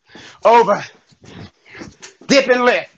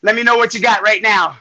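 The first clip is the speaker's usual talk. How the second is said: normal talking voice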